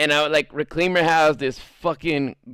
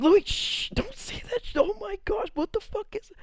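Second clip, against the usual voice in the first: very high, very soft squeak; squeaky hushed scolding; 6 dB quieter than usual. Retold or imitated. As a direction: hiss it high and quiet. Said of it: "look at me like".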